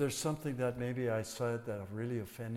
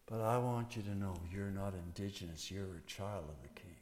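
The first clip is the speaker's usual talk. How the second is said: low, soft and sincere; tender reassurance; 6 semitones lower, 7 dB quieter than usual. Acted. Pastor assuring congregation they are God's children, softly. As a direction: reassure them gently.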